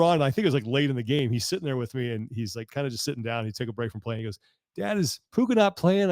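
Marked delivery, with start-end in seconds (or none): 4.8-6.1 put-on higher, slow little boy voice; lightly voicing a small son; a four-year-old asking his dad; mock little kid.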